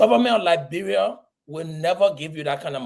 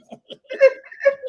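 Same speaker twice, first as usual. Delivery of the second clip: laughter; 5 dB louder than usual. Real, not acted. Laughs at own joke.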